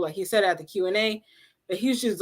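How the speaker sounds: ordinary talk, nothing marked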